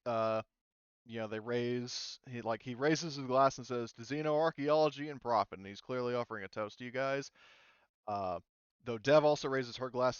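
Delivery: ordinary delivery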